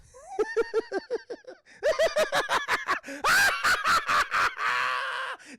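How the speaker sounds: long, high, loud cackling villain laugh; maniacal Joker laugh. Retold or imitated. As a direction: cackle like the Joker enjoying his prank. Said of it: "The Joker's just laughing his ass off".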